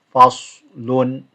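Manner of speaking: Faslun with a heavy s and rounded lips; heavy sad. Shown as a demonstration. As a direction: Faslun with lips coming in together on the s. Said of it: "bringing the mouth in and your lips are coming in together".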